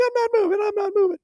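very high, loud frantic squeal; high-pitched imitation of frightened pleading; panicked surrender. Voices the pinned man giving in.

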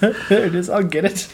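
high, laughing echo. Retold or imitated. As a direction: laugh through it. Echoes Corey, laughing.

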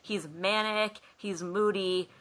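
ordinary talk

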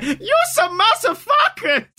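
high, laughing yell from far away; distant yell. Retold or imitated. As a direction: shout it while running off, breaking into laughter. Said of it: "from afar, you just hear".

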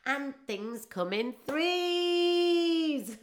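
slow mock declaration. Mock social media post.

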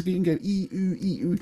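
"E" then "Oo" with lips spread then rounded, switching back and forth; vowel demo. Shown as a demonstration. Coach shows the lip cheat by switching "E" and "Oo". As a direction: alternate "E" and "Oo" by moving the lips. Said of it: "So if you go E or Oo, if you move your lips".

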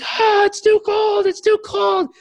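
very high, loud freezing wail; hammed-up falsetto distress; mock panicked shriek. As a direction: shriek as the icy water hits. Imitating client shrieking in cold shower.

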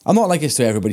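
normal talking voice

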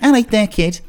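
high, short mock Mancunian; punchy Mancunian impression, pitched well up; Mancunian accent. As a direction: imitate a Mancunian character. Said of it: "And then the Mank was more like".

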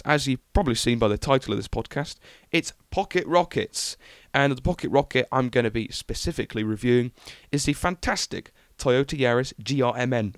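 ordinary delivery

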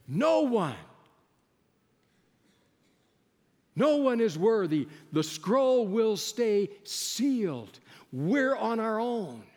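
solemn pulpit proclamation; emphatic preaching. Acted. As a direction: proclaim it to the whole hall. Preacher declares verdict.

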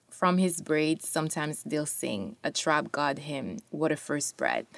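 soft, hushed poem reading; quiet poetry reading. Acted. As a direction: read it near a whisper. Reads second poem aloud.

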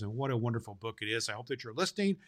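ordinary delivery

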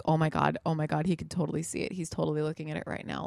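fast, hushed panic; panicked. Retold or imitated. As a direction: rush it out, panicking under your breath. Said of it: "I'm fucking panicking".

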